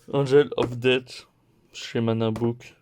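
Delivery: usual speaking voice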